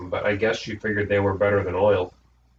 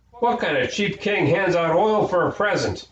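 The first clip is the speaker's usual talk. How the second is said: outraged; 9 semitones higher than usual. A narrator's reading. Imogene outraged at the gift.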